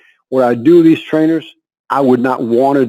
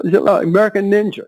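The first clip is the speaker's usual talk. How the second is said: ordinary talk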